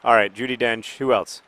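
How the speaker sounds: ordinary delivery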